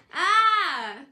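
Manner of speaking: loud raw cry into the cold night; loud scream. A narrator's reading. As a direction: let it all out in one yell. Richie screams into the snow.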